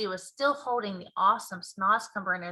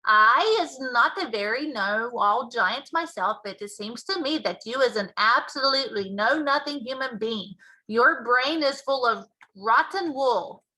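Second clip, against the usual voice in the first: BFG giant voice; 2 semitones higher, 6 dB louder than usual. Acted. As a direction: boom out the giant's mangled grammar.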